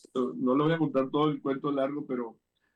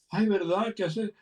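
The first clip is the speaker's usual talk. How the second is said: higher and louder, a lightly voiced surprised wife; amazed ay, pitched up; 8 semitones higher, 4 dB louder than usual. Wife's reply voiced in story. (esposa sorprendida, voz aguda y más fuerte)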